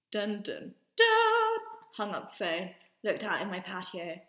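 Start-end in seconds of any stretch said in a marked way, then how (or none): 0.1-1.6 mock suspense sting, last note high and loud; dramatic sting. Storyteller punctuating the caller's reveal, mock dramatic sting. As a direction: sound out a dun-dun-dun, leaping up at the end.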